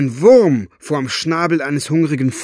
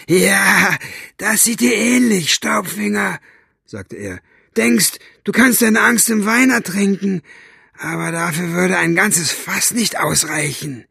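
gruff jailer voice; 8 semitones higher than usual. Acted. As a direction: sneer at him like a brutish guard. Buster mocking Staubfinger.

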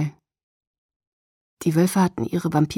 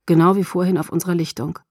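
usual speaking voice

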